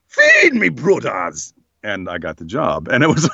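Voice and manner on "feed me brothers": high, hammy Jamaican troll eagle voice; exaggerated Jamaican accent for a troll; bad Jamaican accent. Shown as a demonstration. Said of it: "do a bad Jamaican accent".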